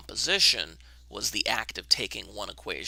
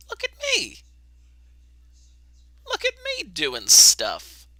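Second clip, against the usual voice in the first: much higher, squealing with delight, then louder and slower; gleeful showing off; 10 dB louder than usual. Real, not acted. Teacher proud the camera finally works, squealing with glee. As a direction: crow with delight at your own success.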